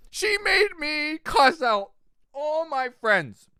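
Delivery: slow, high, drawn-out outrage; slow indignant complaint. Acted. Complaining to viewers about Michelle, slow and indignant. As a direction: stretch it out in mock disbelief.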